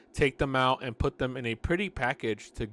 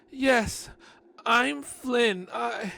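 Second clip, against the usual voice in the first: high nervous voice; 11 semitones higher, 5 dB louder, much slower than usual. Acted. Narrator voicing himself, higher.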